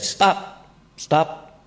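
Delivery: urgent high-pitched stop; eager stop. Retold or imitated. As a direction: cut them off, excited. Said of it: "She said".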